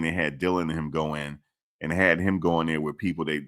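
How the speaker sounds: ordinary talk, nothing marked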